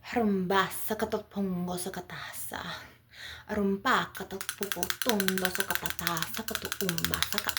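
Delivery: punchy channelled chant breaking into short separate syllables; assertive light language. Acted. Channelling an assertive mother dragon's message to listeners, assertive. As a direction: fire out each syllable with assertive force. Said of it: "she's very assertive with her messages".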